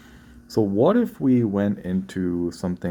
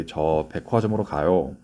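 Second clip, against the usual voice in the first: 저 백화점으로 가요 rattled off fast in one breath; fast natural Korean; rushed Korean line; 2 semitones lower, much faster than usual. Shown as a demonstration. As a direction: blurt 저 백화점으로 가요 quickly as one run. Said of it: "Excuse me".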